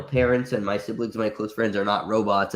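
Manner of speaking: ordinary delivery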